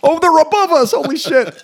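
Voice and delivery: high-pitched pretend fright; mock alarm. Friend spotting attackers in the ceiling, mock alarm. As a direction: gasp out the discovery, hamming it up.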